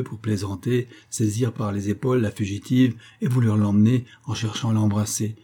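ordinary delivery, nothing marked

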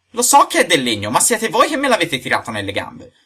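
loud, high-pitched blame; angry accusation. Acted. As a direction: insist hotly it's their fault. Acted quarrel, accusation.

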